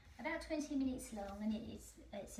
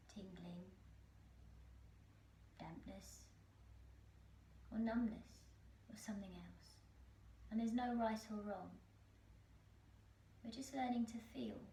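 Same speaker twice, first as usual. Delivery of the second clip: guided meditation; 6 dB quieter than usual. Acted. Teacher guiding listeners through a body scan, calm meditation voice. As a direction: lead them gently through the breath, unhurried, phrase by phrase.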